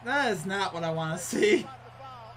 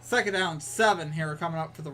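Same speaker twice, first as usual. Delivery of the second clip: live play-by-play; 2 semitones higher, 5 dB louder than usual. Acted. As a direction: call the play like a TV announcer.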